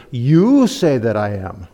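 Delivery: slow, pointed emphasis on YOU; stress on YOU. Retold or imitated. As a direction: lean long and hard on the word YOU. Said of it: "she added the inflection".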